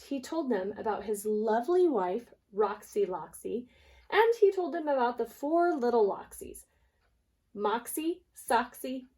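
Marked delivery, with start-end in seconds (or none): none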